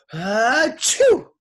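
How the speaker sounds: high, explosive achoo; huge sneeze. A narrator's reading. Teacher with pepper in his face, explosive sneeze. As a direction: build up and blast out a sneeze. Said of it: "sneezed again".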